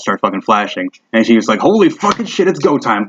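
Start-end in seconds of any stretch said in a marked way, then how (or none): 1.6-3.1 high-pitched burst that settles; rally the squad as the alarm goes off; excited rallying cry. Acted. Alarm lights flash, leader rallies squad.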